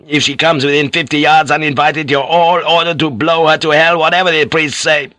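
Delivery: high, forceful captain's orders; shouted order. Acted. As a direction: shout orders to the whole crew. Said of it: "he shouted".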